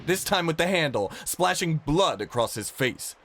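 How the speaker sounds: ordinary delivery, nothing marked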